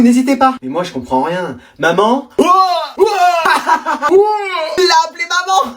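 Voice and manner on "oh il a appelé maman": excited shout. Acted. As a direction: shout it out in glee.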